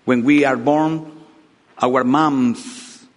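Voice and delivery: normal talking voice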